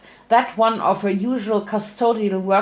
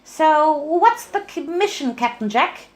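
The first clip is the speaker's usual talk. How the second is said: high, bubbly smiling voice; high chirpy Pinkie voice; smiling Pinky; 7 semitones higher than usual. Acted. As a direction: say it with a grin. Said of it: "the pink pony saluted".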